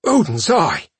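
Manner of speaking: high startled exclamation; exclaiming. Acted. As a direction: blurt it out in shock. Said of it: "he exclaimed".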